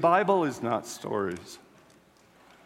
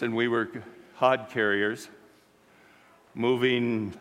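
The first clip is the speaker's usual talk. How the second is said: normal talking voice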